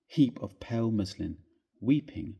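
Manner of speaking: usual speaking voice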